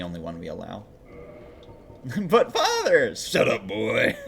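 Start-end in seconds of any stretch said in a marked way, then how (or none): 2.3-3.2 put-on high, drawn-out boy's protest; Atreus. Game son protesting to his father, squeaky. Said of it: "Atreus comes out with a squeaky voice". 3.2-4.2 lightly voiced stern father; a hint of the gruff game father, played for laughs; Kratos. Game father cutting off his son, mock stern. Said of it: "always does the Kratos one".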